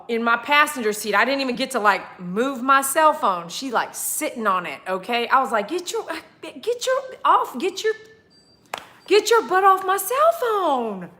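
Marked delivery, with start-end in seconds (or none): 5.7-11.1 high shooing command. Retold or imitated. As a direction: shoo the dog away, pitched up. Shooing dog off phone.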